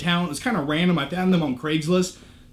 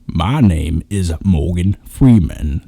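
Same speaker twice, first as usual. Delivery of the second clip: much lower, slow, deep narrator voice; Morgan Freeman impression; 14 semitones lower, 4 dB louder, slower than usual. Vlogger introducing himself as Morgan Freeman, low and slow. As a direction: do your best Morgan Freeman, low and unhurried. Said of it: "That's my Morgan Freeman impression".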